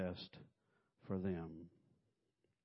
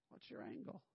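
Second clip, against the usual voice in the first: whispered suspicion; 10 semitones higher, 11 dB quieter than usual. Retold or imitated. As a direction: whisper the question. Repeated suspicious question.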